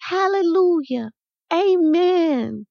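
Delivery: very high, drawn-out joyful church shout; two kids shouting praise, voiced high without full volume; high-pitched, stretched hallelujah cry; shouting along. A narrator's reading. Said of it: "and shouted away".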